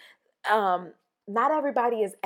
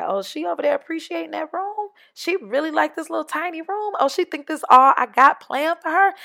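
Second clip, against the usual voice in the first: much higher, amused, sassy voice; voice of the universe; 8 semitones higher, 5 dB louder than usual. Retold or imitated. The universe gossiping about a grateful woman, higher and sassy. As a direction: tease her like a knowing friend who has big plans.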